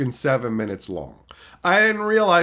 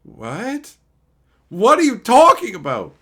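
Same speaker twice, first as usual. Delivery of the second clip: baffled reaction; 7 semitones higher, 7 dB louder than usual. Retold or imitated. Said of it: "And it's just like".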